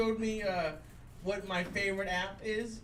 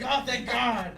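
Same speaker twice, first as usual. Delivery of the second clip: mock excited relief; 3 semitones higher, 6 dB louder, slower than usual. Imitating them reacting to a news report, mock excited. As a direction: exclaim it like thrilling news. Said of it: "they would get excited".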